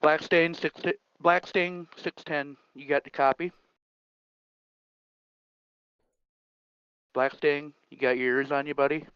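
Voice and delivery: loud trucker call over CB radio; radio call, crackly and loud. Acted. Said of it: "the final call, uh, by Farmer Kurt over what would have been the C B".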